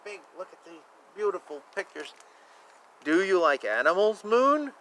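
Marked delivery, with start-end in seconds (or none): none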